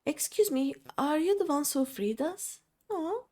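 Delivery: gentle grateful captive; timid freed girl. Acted. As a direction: thank them politely and nervously. Reads the freed girl's lines aloud.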